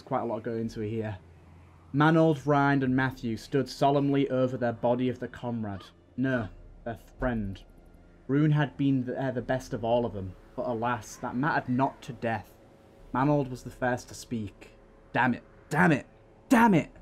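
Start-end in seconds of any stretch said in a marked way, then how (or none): 1.9-14.8 steady, dramatic storytelling read; story narration. A narrator's reading. Reads second diary aloud. 15.1-16.9 frustration breaking out in a cry; angry shout. Acted. Warrior mourns fallen comrade.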